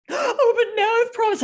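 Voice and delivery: high, loud wail of a distraught client; hammed-up sobbing whine; mock crying. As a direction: imitate a tearful protest. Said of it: "Stop, stop crying right now".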